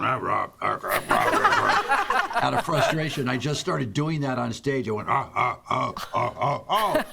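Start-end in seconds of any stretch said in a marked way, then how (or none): 0.0-1.8 very low, loud mock chatter; imitated men's banquet rumble. Comic mimicking men at a sales banquet, low rumbling noise. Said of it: "all I heard was men going". 5.1-7.0 string of questioning grunts; comic grunting. Shown as a demonstration. Comic showing what he did on stage, repeated grunts. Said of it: "doing the grunt".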